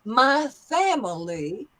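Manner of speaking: slow drawl, long Southern vowels; Southern accent, drop the g on "going". Shown as a demonstration. Teacher shows long vowels, Southern accent. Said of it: "Notice the long vowels and the G dropped".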